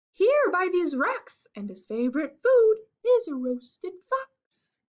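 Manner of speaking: a bright little mouse, politely refusing. Acted. Mouse turns down the fox, high and squeaky.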